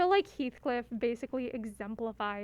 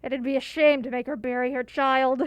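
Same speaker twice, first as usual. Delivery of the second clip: tough bully voice; 2 semitones lower, 4 dB louder than usual. Acted. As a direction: threaten them like a tough bully. Reading Susie's threats in game.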